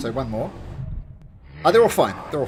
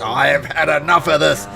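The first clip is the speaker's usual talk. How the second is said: louder, forceful but contained cry; fed-up outburst; 7 dB louder than usual. Acted. Captain about to hack the mouther, loud fed-up cry. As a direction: declare it loudly, patience gone. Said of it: "he's just".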